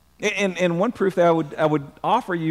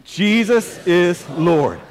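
like you mean it; much slower than usual. Shown as a demonstration. Preacher leading the congregation to say it like they mean it, emphatic declaration. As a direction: declare it slowly and with full conviction. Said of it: "now say it like you mean it".

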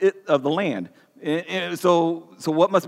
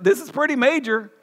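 higher-pitched, driving sermon voice; urgent preaching; 6 semitones higher than usual. Acted. Pastor urging his congregation that good people must change, fervent.

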